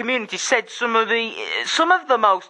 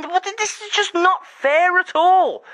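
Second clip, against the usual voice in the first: outraged disbelief; 10 semitones higher, 3 dB louder, slower than usual. Retold or imitated. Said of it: "And I'm just thinking".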